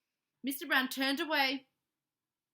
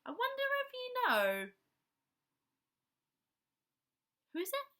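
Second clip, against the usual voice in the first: put-on high playful question to the child; questioning lilt; 11 semitones higher than usual. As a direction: coax an answer from a little listener. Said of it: "quizzing a child listener".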